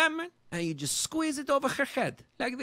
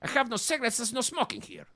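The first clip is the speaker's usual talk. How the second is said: normal talking voice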